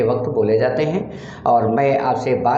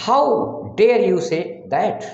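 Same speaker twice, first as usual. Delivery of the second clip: 1 slow demo; 6 semitones higher, much slower than usual. Shown as a demonstration. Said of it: "teacher demonstrates English sentence".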